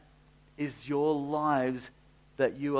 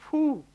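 amazed whew; 6 dB louder than usual. Real, not acted. Reacting to own story.

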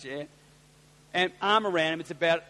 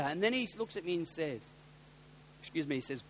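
ordinary delivery

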